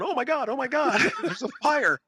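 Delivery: high-pitched mock panic; imitated panicking friend. Retelling friend running around at the battery fire, mock panic. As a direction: act out a friend flapping in alarm.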